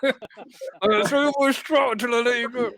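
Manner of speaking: tough threat. Retold or imitated. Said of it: "And I said".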